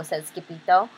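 plain reading voice

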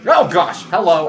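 loud jumpy yelp. Real, not acted. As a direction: caught off guard, jolted. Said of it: "I am so jumpy right now".

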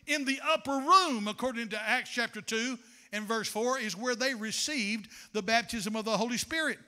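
normal talking voice

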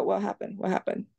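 a hushed, bewildered what happened; puzzled. Retold or imitated. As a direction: ask quietly, confused. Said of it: "And I go".

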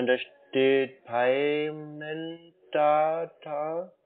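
usual speaking voice

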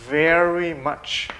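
very slow, each sound drawn out; slow model reading. Shown as a demonstration. Teacher drawing out "very much" slowly so pupils copy. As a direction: stretch "very much" out slowly for the class. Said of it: "teacher models slowly".

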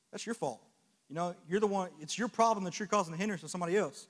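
normal talking voice

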